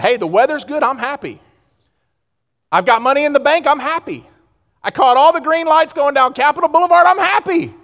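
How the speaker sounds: high, gleeful boasting; hammed-up worldly delight; mock happy. Mimics worldly happiness over externals.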